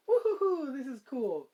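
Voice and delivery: high, loud joyful whoop that settles; excited whoop. Acted. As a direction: cheer it out like a kid on skates. Skating while making ice.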